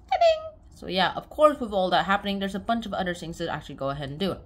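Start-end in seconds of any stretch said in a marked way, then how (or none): 0.1-0.5 high sung chime; ta-ding chime. Pretend. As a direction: sing it like a jingle. Sound effect exclamation.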